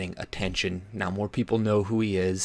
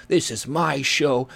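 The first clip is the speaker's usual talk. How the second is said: mock bossy; 3 semitones higher, 6 dB louder than usual. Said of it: "swing his dick around, being like".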